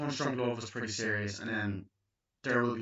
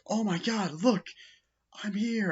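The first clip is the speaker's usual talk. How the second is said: high mock gasp; 11 semitones higher than usual. Reviewer imitating a starstruck movie character, high mock gasp. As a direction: voice jumps way up in mock amazement.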